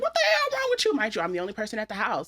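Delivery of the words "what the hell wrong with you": high alarmed cry of shock; shocked yell. Retold or imitated. Mother spotting her baby's rash, high shocked yell. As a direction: blurt it out in sudden alarm.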